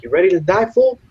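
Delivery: high, rising mock menacing taunt; thug threat. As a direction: threaten him like a cocky gang kid. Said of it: "so they were like".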